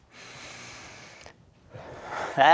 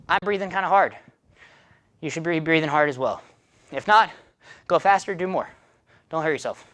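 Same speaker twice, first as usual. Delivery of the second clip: usual speaking voice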